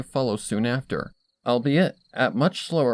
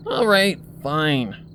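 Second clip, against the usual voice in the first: loud, higher "All right", then a sulky "fine"; grudging concession; 4 semitones higher, 3 dB louder, much slower than usual. A narrator's reading. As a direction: give in irritably after a groan. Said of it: "she concedes".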